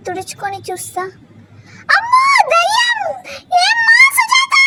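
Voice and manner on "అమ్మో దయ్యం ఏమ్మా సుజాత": shrieking high in sudden fright at a ghost; terrified scream. Acted. Grandmother sees a ghost in the kitchen, terrified shriek. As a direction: scream it out as if a ghost just appeared.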